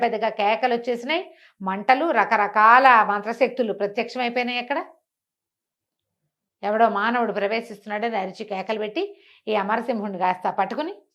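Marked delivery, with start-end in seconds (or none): none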